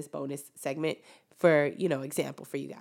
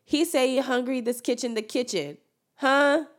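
playful demo voice; 6 semitones higher, 3 dB louder than usual. Shown as a demonstration. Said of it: "you can substitute the word".

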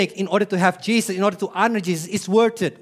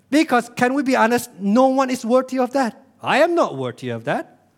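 ordinary talk

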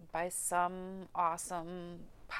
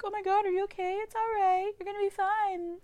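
much higher imitated cooing; fussing concerned onlookers; 13 semitones higher than usual. Classmates reassuring a crying graduate, high-pitched fussing imitation. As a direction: mimic people fussing over you in a high voice.

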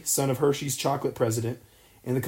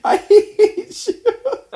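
laughing through the words; cracking up mid-line; 9 dB louder, much slower than usual. Real, not acted. Laughing at co-host's fact.

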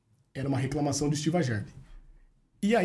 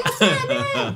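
retold shout; 3 semitones lower, 6 dB louder, much slower than usual. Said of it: "ela deu um grito".

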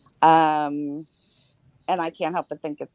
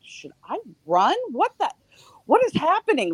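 much higher-pitched, bewildered self-questioning; retold panic; 11 semitones higher than usual. Woman to herself in her broken-into home, retold panic. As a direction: replay rising panic without shouting.

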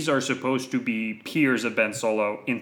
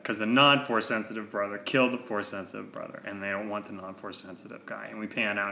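ordinary delivery

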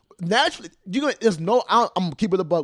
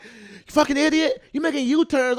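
imitated angry neighbor; 9 semitones higher than usual. Irate dog walker cussing out a driver, imitated in a high voice. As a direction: put on the scolding neighbor's high voice. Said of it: "if he heard his fucking voice".

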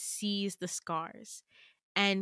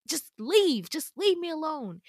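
higher, louder angry plea; exasperated demand; 7 semitones higher, 5 dB louder than usual. Acted. Girl wanting Damien out of her way, exasperated demand. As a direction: push them away with insistent words. Acted furious quarrel.

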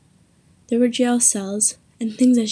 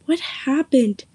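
higher, louder repeated demand; yelled question; 4 semitones higher, 4 dB louder, slower than usual. A narrator's reading. Mason running up and demanding an answer, a mild yell. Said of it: "he yelled".

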